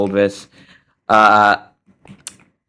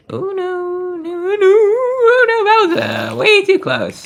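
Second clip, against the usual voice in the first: panicked squeal; slower than usual. Real, not acted. Near miss in game.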